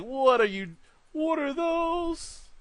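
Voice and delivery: high, mocking "what are those", stretched slow on "those"; mock viewer squawk. Telling viewers what they're thinking, a high mocking squeal. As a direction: squeal it like a shocked meme. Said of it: "you're probably thinking, like".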